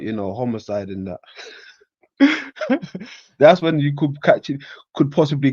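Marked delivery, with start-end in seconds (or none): 2.2-3.3 high-pitched laugh; amused high laughter. Real, not acted. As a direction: break out in a high chuckle. Laughs after joking about homicide.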